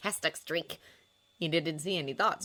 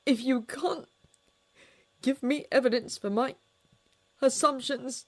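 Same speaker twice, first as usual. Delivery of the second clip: tearful; 8 semitones higher, 6 dB louder than usual. A narrator's reading. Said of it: "the tears in Watson's voice".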